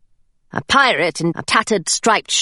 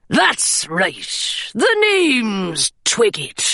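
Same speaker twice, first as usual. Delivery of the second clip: gruff pirate; much slower than usual. Acted. Pirate Twiggett's lines.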